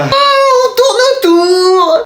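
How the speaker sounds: very high, slow laughing sing-song; laughing drawn-out hint. Acted. Teacher to student circling the answer, high slow laughing sing-song. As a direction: stretch the words out teasing with a laugh.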